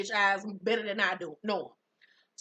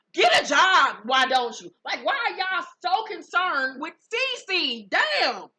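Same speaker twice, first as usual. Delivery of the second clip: real loud, high-pitched scolding; exasperated outburst; 7 semitones higher, 7 dB louder, slower than usual. Real, not acted. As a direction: shout it out, thoroughly fed up. Host scolds the cast in exasperation.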